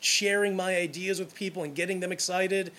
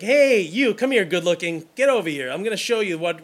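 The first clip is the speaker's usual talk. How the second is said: calling out; 3 semitones higher, 7 dB louder than usual. Retold or imitated. Saleswoman at the mall calling him over, loud and eager. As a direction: hail a passing shopper loudly.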